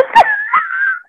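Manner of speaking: very high squealing laugh. Real, not acted. Laughing after naming Candace.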